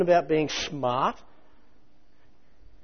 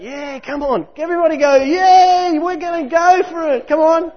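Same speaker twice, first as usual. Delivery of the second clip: high, loud hyped-up cheering; hammed-up shouting of eager believers; mock cheerleading; 11 semitones higher, 11 dB louder than usual. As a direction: whip up the crowd like a pep rally. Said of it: "All of that".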